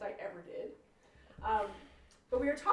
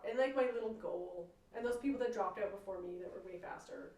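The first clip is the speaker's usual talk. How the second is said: usual speaking voice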